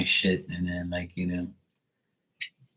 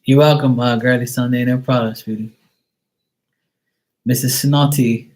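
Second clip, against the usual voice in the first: sarcastic; 4 semitones higher, 6 dB louder than usual. Acted. Mock thanks to viewer.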